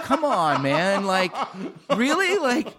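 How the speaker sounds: high, whiny mock complaint; squeaky exasperated protest about his name; exasperated self-quote. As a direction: pitch it up like a fed-up whine. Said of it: "I'm like".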